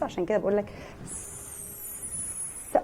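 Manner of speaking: soft unvoiced hiss on س-. Shown as a demonstration. Teacher hissing س- like a snake. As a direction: breathe out a long س- like a snake. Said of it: "سنيك".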